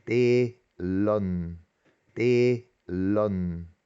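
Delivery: "Telen" split in two, long first E; long double vowel. Shown as a demonstration. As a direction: draw out the first E of "Telen", slowly by syllable. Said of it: "pero se pronuncia doble".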